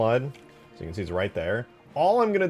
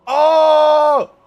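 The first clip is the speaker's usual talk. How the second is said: sustained high-pitched "oh" yell; loud outcry; 17 dB louder than usual. Real, not acted. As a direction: cry out suddenly, high and very loud. Sudden outcry while working.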